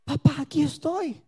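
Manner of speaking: soft, humble reply to God; tender answer. Retold or imitated. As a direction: answer the father softly.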